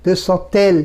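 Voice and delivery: দেশা তেল with a t starting তেল; T-sound liaison. Shown as a demonstration. Teacher models the feminine liaison, তেল said with a t. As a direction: link into তেল with t instead of d. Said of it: "ত বলতে হবে".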